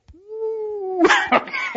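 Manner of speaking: high burst of laughter. Real, not acted. Lecturer laughs at the chain breaking, high and bright. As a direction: laugh at the fall.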